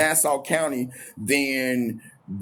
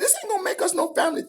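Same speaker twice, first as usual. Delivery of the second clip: much higher, fast, laughing through the words; laughing disbelief; much faster than usual. Retold or imitated. To the girlfriend who turned him in, laughing disbelief. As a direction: laugh in disbelief at her excuse.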